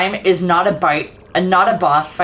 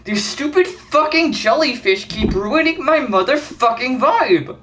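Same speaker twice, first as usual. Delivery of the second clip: higher, strained frustrated rant; angry rant; 5 semitones higher than usual. Real, not acted. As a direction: vent at the jellyfish, truly fed up. Ranting at jellyfish again.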